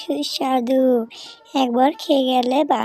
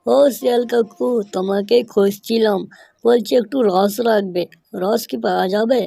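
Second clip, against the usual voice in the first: tiger calling out; 5 semitones lower than usual. Acted. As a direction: boom it out like a big cat at the door. Tiger calls fox at his house.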